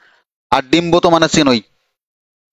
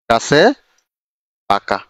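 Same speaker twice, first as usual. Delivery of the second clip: গাছে and পাকা said apart with a pause between; slow dictation for students to copy; word by word; 6 semitones lower, much slower than usual. Shown as a demonstration.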